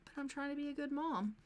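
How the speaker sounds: put-on protesting mom voice; pleading mom. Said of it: "she's like".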